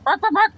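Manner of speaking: playful high bark, bark, bark; dog bark imitation. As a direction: yap like a little dog.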